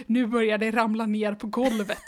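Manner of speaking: ordinary talk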